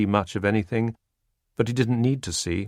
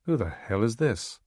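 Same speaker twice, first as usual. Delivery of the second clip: softer, side-of-mouth mutter; out of the corner of the mouth; 6 dB quieter than usual. Acted. Stahl asking Zolly under the cameras, side-of-mouth. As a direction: keep smiling while you mutter it. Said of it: "out of the corner of his mouth he said".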